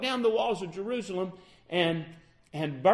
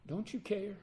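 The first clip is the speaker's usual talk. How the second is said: quiet plea; 8 dB quieter than usual. Acted. Disciples pleading, soft repeat.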